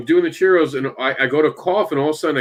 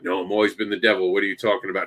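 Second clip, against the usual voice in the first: a pretend devil alter ego, low and softer, lightly played; lower, quieter devil persona; 6 semitones lower, 5 dB quieter, faster than usual. Creator pretending to be his devil character denying his other self, low and hushed. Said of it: "And I wanted to act like".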